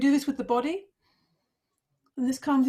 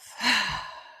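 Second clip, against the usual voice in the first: long breathy exhale through the mouth; audible sigh. Shown as a demonstration. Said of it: "then out through the mouth".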